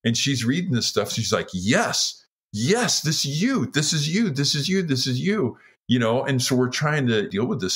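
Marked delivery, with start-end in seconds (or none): 1.5-5.6 high, emphatic repeated insistence; insistent realization. Retold or imitated. Wife telling her husband the symptoms fit him, excited and insistent. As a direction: imitate an excited wife hammering the point home.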